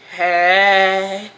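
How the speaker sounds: long drawn-out, level hey; imitated creepy hey. Imitating Stanley on the phone, drawn-out hey. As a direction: stretch out the greeting like a smitten caller. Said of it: "Who else was that doing hey like that?".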